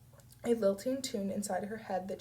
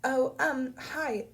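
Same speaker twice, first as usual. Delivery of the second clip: higher, hesitant phone greeting; flustered recovery; 5 semitones higher, 4 dB louder, much slower than usual. A narrator's reading. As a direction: recover awkwardly, a little caught off guard. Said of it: "recovering, clearing her throat".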